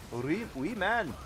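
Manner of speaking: slow Scottish accent on wee man. Shown as a demonstration. Thanking a viewer in a Scottish accent. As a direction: say it like a Scot, drawn out. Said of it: "I feel like I should say it in Scottish".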